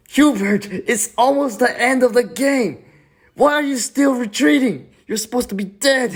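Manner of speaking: high-pitched, loud mock protest; shrill-pitched indignant rant; exasperated scolding. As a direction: yell at the villain in disbelief. Mock player scolding the character.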